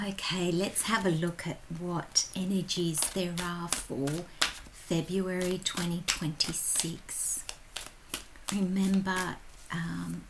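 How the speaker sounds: soft, intimate whisper-like tarot reading; hushed reading voice. Acted. Tarot reader opening the February reading, hushed and soft. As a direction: lean in and read the cards softly.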